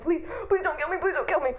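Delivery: a hammed-up plea for mercy; begging. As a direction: beg for your life, half-laughing. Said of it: "begging for his life".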